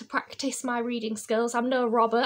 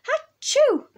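big high, loud mock sneeze; acted sneeze; 14 semitones higher, 8 dB louder than usual. A narrator's reading. Child sneezing in feathers, loud acted sneeze. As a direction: burst out a storybook achoo. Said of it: "I sneezed".

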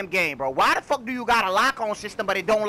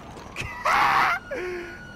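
high laugh. Real, not acted. Laughs after surviving.

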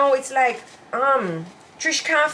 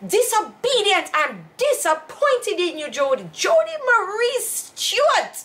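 slow, high, drawn-out full-name scolding; high mock scolding, stretching Jody Marie Stewart; stern mother; 7 semitones higher than usual. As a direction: call the child's full name like an exasperated mom. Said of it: "She used to use big words on me".

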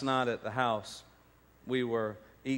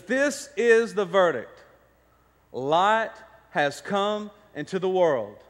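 slow, high, loud scripture proclamation; emphatic preaching; 6 semitones higher, 7 dB louder, slower than usual. Real, not acted. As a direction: proclaim the verse slowly and forcefully. Proclaims the verse to congregation.

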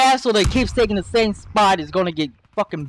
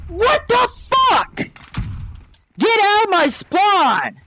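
much higher, slow, stretched-out outrage; furious yell; 9 semitones higher, much slower than usual. Real, not acted. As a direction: drag each word in rage. Enemy in his spawn.

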